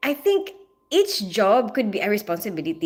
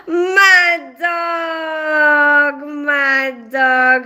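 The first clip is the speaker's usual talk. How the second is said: gasp, then a high, loud happy shout of the name; excited shout; 4 semitones higher, 6 dB louder, much slower than usual. Real, not acted. Greeting a regular viewer who just joined, loud delighted shout. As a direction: call out in delight at a friend showing up.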